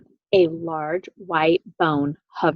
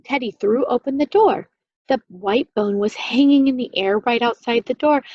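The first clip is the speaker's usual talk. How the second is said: ordinary delivery, nothing marked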